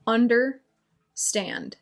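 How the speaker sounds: "Understand" split slowly, a long pause before "stand"; word broken down. Shown as a demonstration. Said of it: "break it down".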